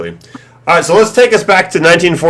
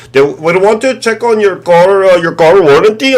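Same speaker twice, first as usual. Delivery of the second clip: scam caller impression; 6 semitones higher than usual. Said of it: "someone from India trying to tell you".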